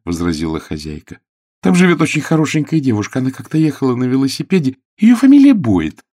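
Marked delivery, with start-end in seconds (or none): 1.6-6.0 high, quick, lively lady's voice; chatty hostess. A narrator's reading. Hostess describing the pretty girl next door, chatty and quick. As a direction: chatter brightly, rising to a loud finish.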